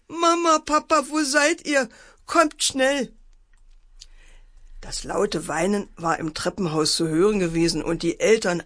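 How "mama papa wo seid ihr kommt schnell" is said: put-on high, wailing child's call; acted wailing. A narrator's reading. Frightened boy calls parents from under covers, acted high wail. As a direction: wail up high for help, storyteller style. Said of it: "begann fürchterlich zu heulen".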